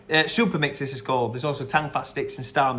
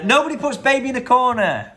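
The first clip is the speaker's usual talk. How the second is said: movie line impression; 10 semitones higher, 6 dB louder than usual. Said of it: "That is from Dirty Dancing".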